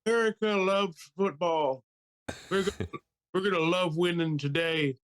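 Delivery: high-pitched mock presidential speech; Joe Biden. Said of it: "I wanna hear the speech".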